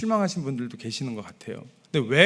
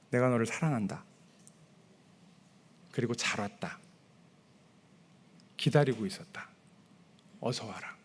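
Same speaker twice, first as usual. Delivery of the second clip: softer, gentle loving father welcoming; God's tender voice; 4 semitones lower, 5 dB quieter than usual. Retold or imitated. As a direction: speak it hushed, as a loving father. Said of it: "하나님의 음성을 들으면".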